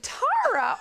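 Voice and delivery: high, loud disbelieving question; shocked mother. Retold or imitated. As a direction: recoil at the name. Mother reacting to the name.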